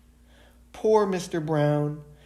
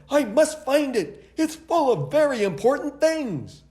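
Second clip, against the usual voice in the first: flustered businessman; 12 semitones higher, 5 dB louder than usual. A narrator's reading. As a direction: fret urgently over the lost case. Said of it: "he said".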